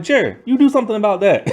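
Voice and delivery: high, quick indignant demand; mock indignant order. Grandpa telling the father to deal with James, mock indignant.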